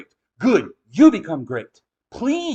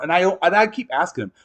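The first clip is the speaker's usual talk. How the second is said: usual speaking voice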